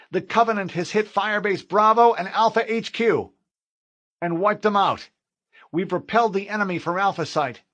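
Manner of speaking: a corporal begging the fleet for help; urgent corporal. Acted. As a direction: push hard for an evacuation. Corporal begs for evac.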